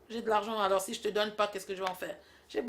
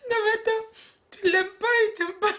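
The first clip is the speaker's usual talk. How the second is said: laughing disbelief; 11 semitones higher, 5 dB louder than usual. Acted. Still laughing at the story.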